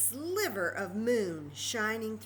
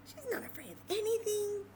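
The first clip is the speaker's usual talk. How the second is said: awed wonder; 13 semitones higher than usual. Acted. Awed repeat for kids.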